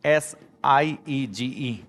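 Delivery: letters spaced out very slowly; slow spelling. Shown as a demonstration. Teacher spelling siege slowly for students. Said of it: "ये वाली जो सीज है".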